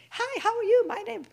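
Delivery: high, loud chirpy greeting; cheery greeter. Retold or imitated. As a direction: play the too-friendly greeter. Greeters at door voiced.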